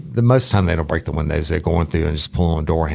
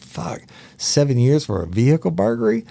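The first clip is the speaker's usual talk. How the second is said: much higher, incredulous retold outburst; retold disbelief; 10 semitones higher than usual. Cop retells shock at the DA's plea offer, higher incredulous outburst.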